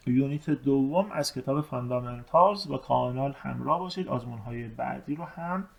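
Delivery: normal talking voice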